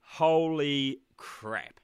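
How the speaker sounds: normal talking voice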